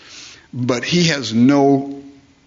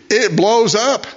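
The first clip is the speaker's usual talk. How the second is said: higher, punchy repeat of the blow-up; exclaimed punchline; 10 semitones higher, 3 dB louder, slower than usual. Real, not acted. Preacher to congregation, exclaiming the pressure cooker blowing up. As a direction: pop the words like the bomb going off.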